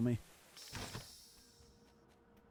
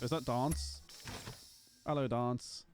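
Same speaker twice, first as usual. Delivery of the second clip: ordinary delivery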